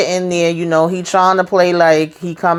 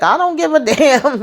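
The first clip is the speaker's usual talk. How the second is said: laughing sass; 9 semitones higher than usual. Retold or imitated. Nancy dismissing Richard.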